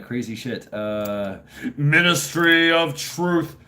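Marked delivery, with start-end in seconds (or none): 1.8-3.6 ominous announcer. Pretend.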